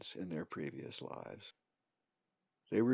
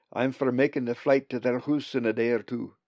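put-on louder Scottish burr, old raven; broad friendly Scots voice; scottish accent; 2 semitones higher, 6 dB louder than usual. Bugs the raven speaks.

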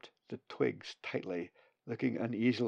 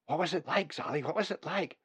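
higher, curious chick voice; eager chick; 6 semitones higher than usual. Acted. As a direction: siblings crowding round, eager. Siblings eagerly asking.

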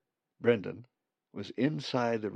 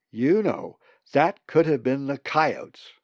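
loud, emphatic telling-off; scolding; 5 semitones higher, 11 dB louder, slower than usual. A narrator's reading. Marg warns the other birds, scolding. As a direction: scold them, leaning hard and slowing down. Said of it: "she scolded".

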